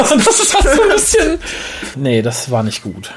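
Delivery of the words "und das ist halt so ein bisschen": laughing. Real, not acted. Laughing at a silly scene.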